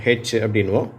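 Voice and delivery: ordinary talk, nothing marked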